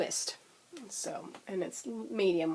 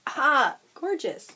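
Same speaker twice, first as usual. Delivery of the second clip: gushing; 6 semitones higher, 6 dB louder, slower than usual. Real, not acted. Gushing over yarn.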